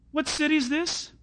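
ordinary delivery

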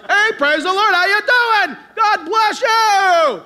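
high, shouted church-folk hello; mock boisterous holler; loud greetings. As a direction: bellow it, dragging out the blessing. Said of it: "We talk like this".

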